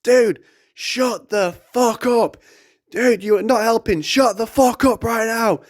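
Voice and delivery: high, strained retold outburst; drawn-out, high-pitched mock yell; exasperated yelling. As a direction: re-enact telling a panicking friend off. Snapping at screaming friend, retold.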